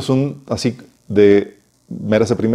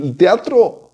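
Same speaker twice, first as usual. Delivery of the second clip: ordinary talk, nothing marked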